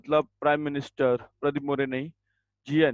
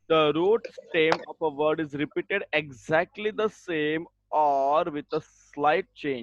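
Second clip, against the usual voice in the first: dictation; much slower than usual. Shown as a demonstration. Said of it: "dictating definition slowly".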